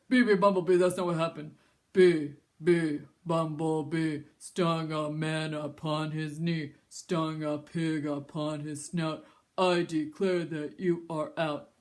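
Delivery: put-on slow, low old grandpa chant. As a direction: drag it out like an old man. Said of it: "your grandpa voice".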